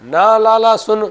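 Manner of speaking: high, loud indignant retort; acted anger. Baba tells Thakur ji he is not needed, acted anger. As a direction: scold loudly as the fed-up old devotee. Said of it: "वो बिल्कुल गुस्से में".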